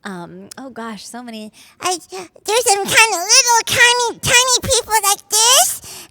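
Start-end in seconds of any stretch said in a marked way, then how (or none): 1.8-6.1 put-on high, slow little old lady voice; squeaky tiny old lady; spry old voice. Shown as a demonstration.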